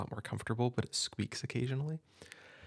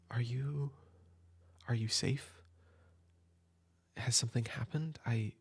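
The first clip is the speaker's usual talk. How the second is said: put-on a gentle older sibling comforting a soaked kid at midnight; an older sibling at midnight, welcoming and comforting the upset kid in from the rain; caring older sibling. Plays a caring sibling at night.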